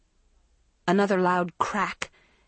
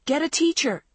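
higher, louder urgent yell; 8 semitones higher, 3 dB louder than usual. A narrator's reading. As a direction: shout for help down the stairwell. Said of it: "somebody yelled".

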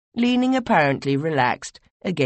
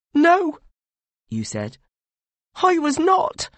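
higher, louder, urgent pleading; anxious young advisor; 14 semitones higher, 4 dB louder than usual. Acted. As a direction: press your lord with dread and worry. You character answers the god.

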